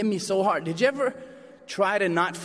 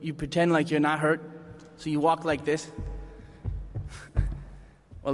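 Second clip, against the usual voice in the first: ordinary talk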